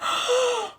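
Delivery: sharp gasp. Retold or imitated. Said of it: "I'm like".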